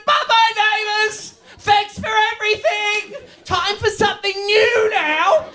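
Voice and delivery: overdone sobbing farewell; mock tearful farewell. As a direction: wave it off in a hammed-up wail. Mock farewell to cancelled show.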